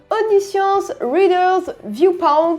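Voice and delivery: self-mocked attempt at an English accent. Real, not acted. Vlogger names the webtoon just finished, attempted English accent. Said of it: "toujours avec mon accent anglais incroyable".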